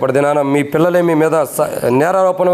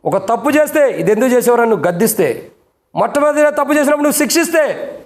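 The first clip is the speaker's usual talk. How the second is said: preaching at full force; 9 semitones higher than usual. Acted. Pastor rebuking parents.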